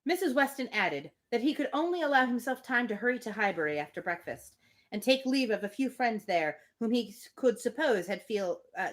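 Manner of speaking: plain reading voice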